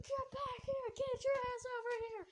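high-pitched mock yell, held back in volume; chasing yell. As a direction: call after someone running away. Acted chaser calling after runaway.

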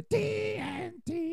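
very high, slow squeak; high squeaky voice. Pretend. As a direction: stretch it out in a squeak. Confused repeat of letters.

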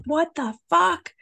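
high, slow, stunned cry; shocked outburst. Retold or imitated. Reacting to the text message, high stunned outburst. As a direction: blurt it out in disbelief. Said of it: "And I was like".

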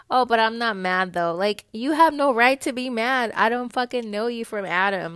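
loud, heated rant; riled up. Real, not acted. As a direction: let the irritation push the volume up. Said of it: "I'm getting all riled up".

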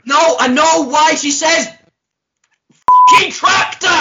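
loud, high-pitched comic outburst; exasperated shout. Real, not acted. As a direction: explode at the co-host, climbing to a screech. Exasperated by co-host's week-old joke.

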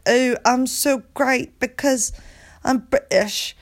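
high, slow, exaggerated British; mock British accent. Podcaster mocking British people as fake, exaggerated British accent. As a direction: ham up a self-important British accent. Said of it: "the British accent is fake".

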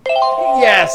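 loud high yes; excited cheer. Real, not acted. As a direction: punch the air with it. Gets the answer right.

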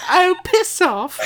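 posh swearing Queen. Pretend. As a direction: drag it out regally through laughter. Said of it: "talk in that accent".

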